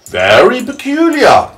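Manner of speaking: high, loud, drawn-out centipede voice. Acted. The centipede answering James, high and slow. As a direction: stretch it out high and grand. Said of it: "said the centipede".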